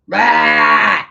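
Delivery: loud, high mock bleat; shouted sheep baa. Mimics a sheep after the sheep question.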